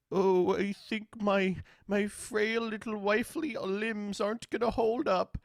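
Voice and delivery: high-pitched mock frail wife; hammed-up fragile, helpless voice. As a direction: whimper it like a delicate, wilting wife. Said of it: "like, frail wife disorder".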